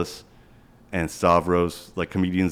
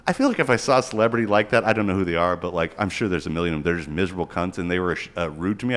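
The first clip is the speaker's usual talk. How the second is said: ordinary talk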